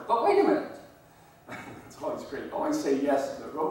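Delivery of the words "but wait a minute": mock innocent. Mock-innocent objection to the cop.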